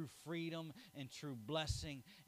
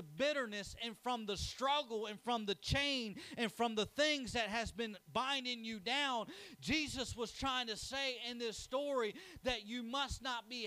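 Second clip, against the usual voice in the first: rising, louder, fervent sermon cadence; building preaching; 8 semitones higher, 5 dB louder than usual. Acted. Preacher calling the congregation to freedom, fervent and rising. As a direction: lift the church with rising fervor.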